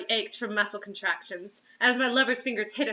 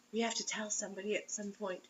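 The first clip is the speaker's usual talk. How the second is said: much softer, hushed line; whisper; 12 dB quieter than usual. Acted. Reader voicing the lover whispering in bed. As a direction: hush it like a secret. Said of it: "she whispered".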